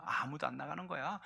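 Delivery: soldiers' quiet fear; frightened hush. Acted. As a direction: answer in scared hush.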